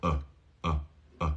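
relaxed neutral "uh"; short repeated "uh" schwa. Shown as a demonstration. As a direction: say "uh" doing nothing with the mouth. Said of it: "Ahí está la schwa".

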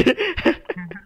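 real laugh after a joke; real chuckling; amused laugh. Real, not acted. Laughs after hyping teammate.

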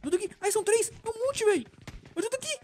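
high frantic pleading; panicking. Real, not acted. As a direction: squeal for help as zombies close in. Zombie grabbing, out of ammo.

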